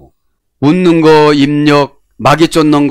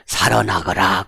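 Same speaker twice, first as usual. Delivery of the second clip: king pardoning; 7 semitones lower, 7 dB quieter, slower than usual. Retold or imitated. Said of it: "사극 봐요".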